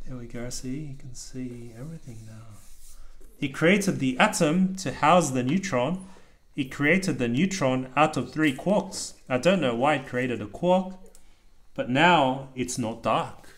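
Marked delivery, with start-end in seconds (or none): 3.4-13.5 steady rhythmic picture-book reading; reading aloud to child. A narrator's reading. Dad reading a rhyming book to his child, lilting read-aloud. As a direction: read the rhyme out to your kid.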